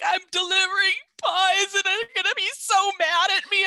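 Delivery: high, loud imitated wail; hammed-up distraught delivery woman; mock distress. Pie driver explaining to the medic, imitated wailing.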